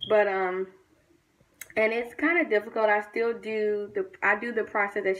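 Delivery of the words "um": ordinary delivery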